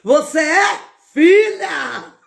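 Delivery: high, loud, drawn-out shout; slow, high shouts of faith; shouting from the crowd. Retold or imitated. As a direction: yell it out across the crowd. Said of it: "eu gritava".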